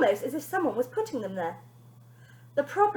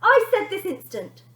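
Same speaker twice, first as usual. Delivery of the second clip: higher, louder sharp command; bossy girl; 8 semitones higher, 7 dB louder than usual. Acted. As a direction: insist like a little dictator. Bonnie repeats her order.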